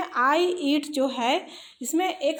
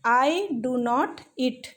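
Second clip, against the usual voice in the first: slow dictation; much slower than usual. Shown as a demonstration. Teacher dictating the model sentence.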